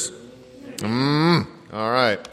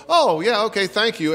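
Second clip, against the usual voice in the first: high, polite brush-off; a breezy, distracted thank-you; 7 semitones higher than usual. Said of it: "and they go".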